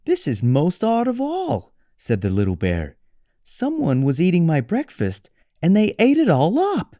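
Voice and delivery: put-on high childlike cub voice; little bear. Little Bear finds his breakfast gone, high cub voice. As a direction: squeak it like a small cub.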